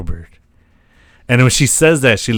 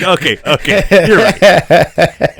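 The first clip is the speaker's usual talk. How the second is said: giving in with a laugh, higher and louder; laughing through the words; 6 semitones higher, 5 dB louder, slower than usual. Retold or imitated. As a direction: laugh while conceding. Retelling her giving in laughing.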